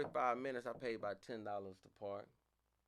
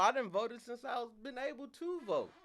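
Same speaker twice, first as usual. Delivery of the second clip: indignant yell; 9 dB louder than usual. Retold or imitated. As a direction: hammer each word in outrage. Outraged at being purged.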